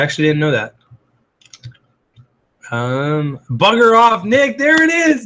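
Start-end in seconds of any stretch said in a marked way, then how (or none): 3.5-4.6 higher and louder, playful yell; excited shout. Real, not acted. Laughing at friend's cheeky chat. 4.6-5.3 very high, louder burst of glee; delighted squeal. Real, not acted. Reacting with delight to chat.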